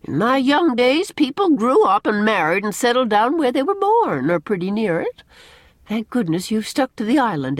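put-on insistent older-generation lecture; Mrs. Rachel Lynde, opinionated. A narrator's reading.

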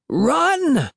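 high, scared yell to run; a boy yelling at his friends to flee; high panicked shout; shrill frightened shout. A narrator's reading. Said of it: "his hoarse voice shrill and frightened".

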